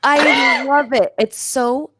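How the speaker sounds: usual speaking voice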